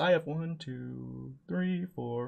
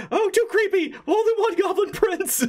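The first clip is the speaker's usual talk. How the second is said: very high laughing goblin voice. Acted. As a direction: squeak it through laughter. Goblin Prince voice.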